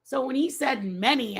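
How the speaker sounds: usual speaking voice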